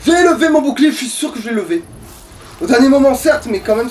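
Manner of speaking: high, loud outraged complaint; indignant protest. Real, not acted. Player protesting to his friend about the unfair hit, real outrage. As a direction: insist you blocked, voice up high and loud. Dies despite raising shield.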